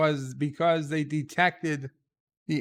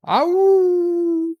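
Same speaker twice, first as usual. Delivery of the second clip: high, loud howl like a beagle; wolf howl; 12 dB louder than usual. Pretend. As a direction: throw your head back and howl. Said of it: "I'm howling like a beagle".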